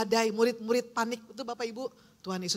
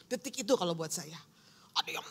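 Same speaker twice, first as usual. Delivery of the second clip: normal talking voice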